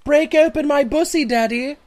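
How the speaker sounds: high, loud mock boy voice; Victorian boy. Teasing the host who hates it, high mock boyish plea. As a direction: beg like a hammed-up Victorian boy. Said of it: "a young Victorian boy".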